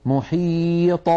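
"محيط" with long ī and bouncing released ط; qalqalah on ṭa. Shown as a demonstration. Teacher modelling correct recitation, "محيط" with echoed ط. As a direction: stretch "محيط" slowly, pop the final ط open. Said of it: "فنقول في السكون".

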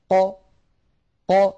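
"ق" popped open, a bouncing release; qaf released by separation. Shown as a demonstration. Tajweed teacher showing how it should exit, echoing released qaf. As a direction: release "ق" by parting the points of articulation. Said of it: "بتباعد التر-، الطرفين".

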